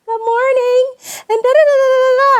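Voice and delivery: very high, loud singsong greeting; lovely professional voice. Retold or imitated. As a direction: chirp it out in an exaggerated professional voice. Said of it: "with my lovely professional voice".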